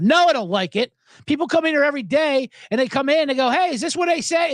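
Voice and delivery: high-pitched exasperated rant; worked-up mock tirade; snapped. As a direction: imitate a diner owner losing his patience. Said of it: "the owner of his diner just snapped".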